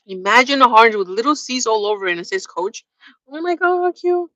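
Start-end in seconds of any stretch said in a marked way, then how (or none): 3.3-4.3 high squeal of delight; gushing. Real, not acted. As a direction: swoon over something adorable. Gushing over cute harness.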